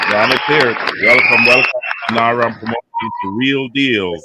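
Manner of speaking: loud announcer-style welcome, calling the name out; hype welcome. Real, not acted. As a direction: call him onto the show like an MC. Host hypes guest onto the show.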